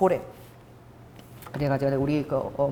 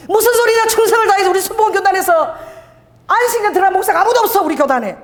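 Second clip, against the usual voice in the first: scolding senior pastor; 8 semitones higher than usual. Retold or imitated. Senior pastor rejecting her sabbatical request, high indignant scolding. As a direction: scoff at the very idea, indignant.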